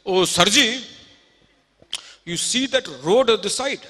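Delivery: put-on Oh, Sarji called out in a rustic Haryanvi accent; Haryanvi farmer. Proud Haryanvi farmer shows the Texan his land, loud rustic accent. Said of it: "He said".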